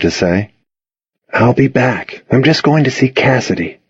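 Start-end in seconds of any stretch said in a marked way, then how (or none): none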